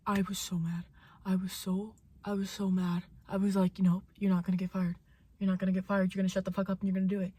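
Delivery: low, quiet contained anger; seething self-talk. Retold or imitated. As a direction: talk yourself down under your breath. Seething self-talk after outburst.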